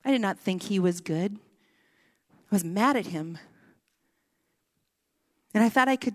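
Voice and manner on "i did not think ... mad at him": ordinary delivery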